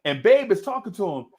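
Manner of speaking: ordinary talk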